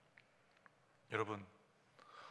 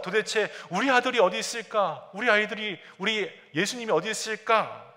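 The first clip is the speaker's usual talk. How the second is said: higher, louder frantic calling for a lost son; alarmed searching parents; 7 semitones higher, 5 dB louder than usual. Retold or imitated. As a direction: cry out in sudden panic for the missing child. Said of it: "깜짝 놀라서". (놀라서 다급하게 아이를 찾는 목소리)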